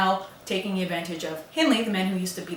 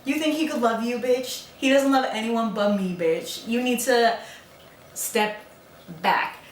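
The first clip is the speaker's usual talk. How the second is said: mean teasing; 3 semitones higher than usual. Acted. Woman teasing sister-in-law over crush, mocking taunt. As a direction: taunt her, then space out the last words. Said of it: "ends up teasing the fuck out of Isabella".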